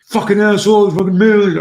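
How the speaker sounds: high, loud imitation of a furious teen; angry yelling. Furious student yelling at his coach, angry shouting. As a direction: burst into a retold yell, pitch shooting up. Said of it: "he starts yelling at me".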